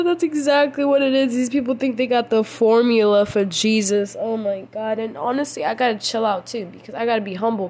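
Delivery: usual speaking voice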